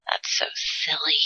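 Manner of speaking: unvoiced whisper to self; quiet amused aside whisper. Real, not acted.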